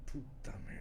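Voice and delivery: whispered "Puta merda" in dismay; muttered curse. Retold or imitated. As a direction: swear to yourself so no one hears. Shocked aside during sales visit.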